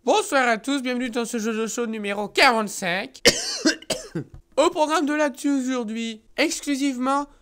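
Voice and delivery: upbeat presenter opening; show host intro. Acted. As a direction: announce it like a TV host. Opens the show.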